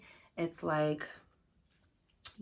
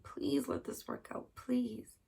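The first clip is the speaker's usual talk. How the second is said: mock pleading; 8 semitones higher, slower than usual. Voicing a pleading wish.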